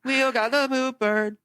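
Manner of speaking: slow, high mocking schoolkid jeer; exaggerated playground teasing, high and slow; drawn-out taunt. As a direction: stretch it out to rub it in.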